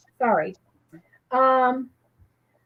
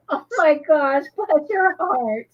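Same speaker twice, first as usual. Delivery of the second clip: tender sympathy; 5 semitones higher, slower than usual. Real, not acted. Host touched by a viewer's childhood mishap, slow and tender. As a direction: coo it with sympathy.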